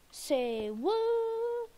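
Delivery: squeaky high say whoa; high silly voice. Pretend. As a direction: pitch it way up for fun. High mocking call.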